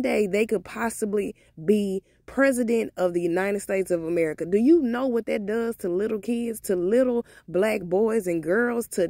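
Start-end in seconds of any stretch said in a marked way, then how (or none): none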